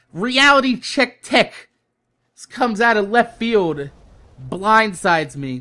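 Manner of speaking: ordinary delivery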